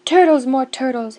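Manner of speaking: loud, booming call; bellowing. Acted. Yertle bellows for turtles, loud and booming. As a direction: bellow across the pond. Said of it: "he bellowed and bayed".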